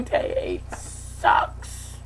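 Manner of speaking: low, very slow whisper; whispered gripe. Real, not acted. Whispering to the camera that day eight sucks. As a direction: breathe it out under your breath.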